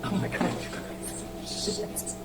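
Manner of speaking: breathless whispered shit; real hushed curse. Real, not acted. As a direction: swear under the breath. Glass exploded, muttered curse.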